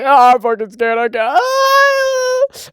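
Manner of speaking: hammed-up terrified sobbing yell; scared mock wail. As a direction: wail like a panicking coward, hammed up. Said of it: "And he's like".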